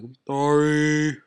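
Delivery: slow, drawn-out fake sorry; cliche apology. Kid forced to apologize to head counselor, slow fake sorry. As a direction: drag out a put-on apology. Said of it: "I'm like".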